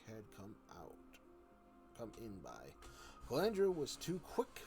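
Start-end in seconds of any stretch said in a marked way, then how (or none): none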